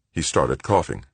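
ordinary delivery, nothing marked

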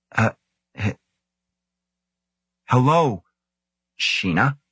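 halting, nervous stammered greeting; struggling to find voice. A narrator's reading. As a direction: stammer out a timid hello with long gaps. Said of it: "It took Grant two hard swallows to find his voice".

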